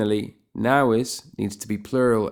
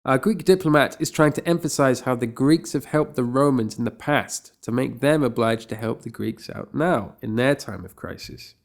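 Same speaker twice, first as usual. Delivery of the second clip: usual speaking voice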